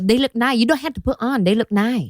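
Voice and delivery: put-on Vietnamese nail tech accent. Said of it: "accent, this character".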